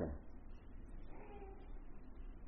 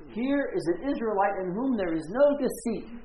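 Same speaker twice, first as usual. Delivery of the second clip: higher, louder proclamation; a raised, declaring voice; Jesus proclaiming; 7 semitones higher, 5 dB louder than usual. Retold or imitated. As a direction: announce it to the crowd. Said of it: "and he says".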